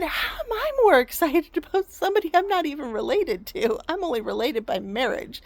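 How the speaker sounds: incredulous, animated retold questioning; worked-up mock astonishment; imitating excited dad. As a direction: press the point in disbelief, swinging up high. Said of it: "He, he said".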